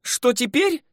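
very high, mocking girl's echo; high, huffy retort; displeased scoff. A narrator's reading. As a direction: echo the question with irritation. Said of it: "недовольно хмыкнула веснушчатая".

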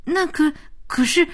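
hesitant, intimidated stutter; cowed stammer. Acted. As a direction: try weakly to get a word in. Stammers under the scolding.

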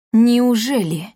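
drawn-out menacing question; sinister, lingering disbelief; ominous drawl. A narrator's reading. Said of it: "зловеще протянул Эдвин".

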